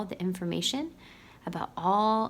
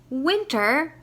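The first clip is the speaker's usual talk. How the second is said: Owl crying out high and loud; cried out; 7 semitones higher, 4 dB louder than usual. A narrator's reading. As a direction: call his name in alarm. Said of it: "cried Owl".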